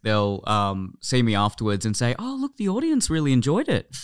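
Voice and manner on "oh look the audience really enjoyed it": high, softer, falsely pleased backhanded praise; passive aggressive. Retold or imitated. Parents to comedian son after his live show, passive aggressive. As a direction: imitate a backhanded compliment in a raised voice. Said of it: "It's the most passive aggressive way".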